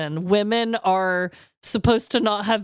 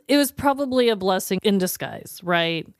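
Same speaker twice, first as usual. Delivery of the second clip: ordinary talk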